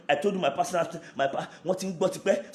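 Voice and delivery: usual speaking voice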